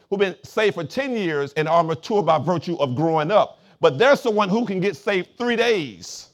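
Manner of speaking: loud, emphatic sermon delivery; forceful preaching. Real, not acted. Pastor explaining spiritual maturity to the church, forceful preaching. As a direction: drive the point home to the congregation.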